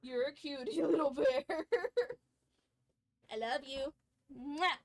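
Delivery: dote on the cat lovingly; cooing pet talk. Real, not acted.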